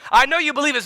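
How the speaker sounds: high, loud insistence; emphatic public call-out; bold challenge. Acted. Acts Paul pressing Agrippa publicly.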